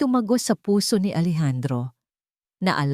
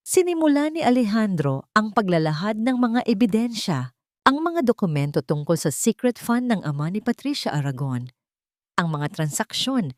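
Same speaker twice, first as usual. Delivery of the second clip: ordinary delivery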